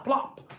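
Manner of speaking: high popping plop; plop sound effect. A narrator's reading. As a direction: pop it like a cork.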